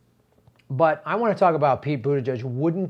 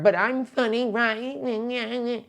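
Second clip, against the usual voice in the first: higher, pleading self-satisfied voice; mock Klobuchar; 8 semitones higher, much slower than usual. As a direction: fish for a laugh as Klobuchar.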